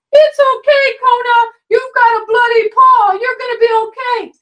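put-on high, sing-song baby talk to a hurt dog. Shown as a demonstration. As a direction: coo over the dog like a fretting owner. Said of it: "we baby talk the pet".

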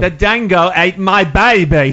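loud, slow, hammed-up Australian accent; mock Australian accent. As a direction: belt it out like a cartoon Aussie, slow and loud. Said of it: "Can you do an Australian accent?".

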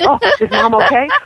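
high, loud anxious doggy voice; worried dog. Retold or imitated. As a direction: fret aloud as the concerned pet. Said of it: "because my voice is elevated".

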